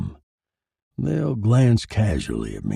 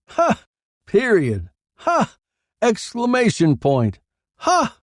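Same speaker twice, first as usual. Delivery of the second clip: mock laughs; 13 semitones higher, 4 dB louder than usual. Reporter dictates mocking laughs.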